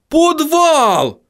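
high dwarf exclamation; high-pitched dwarf eureka; sudden realization. A narrator's reading. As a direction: cry it out high as it dawns on you. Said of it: "вспомнил Хороша гора".

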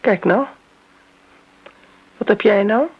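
suddenly alarmed, voice jumping up; startled outburst. Acted.

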